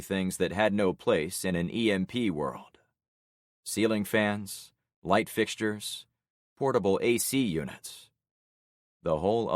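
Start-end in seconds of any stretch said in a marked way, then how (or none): none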